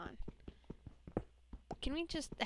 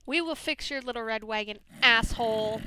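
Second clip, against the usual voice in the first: louder vengeful vow, asshole shouted; loud threat; 9 dB louder than usual. Real, not acted. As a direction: promise payback. Threatening the zombie.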